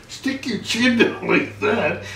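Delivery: slow, laughing demonstration; slow, laughing show of how; laughing demo. Retold or imitated. Salesman demonstrating a fix.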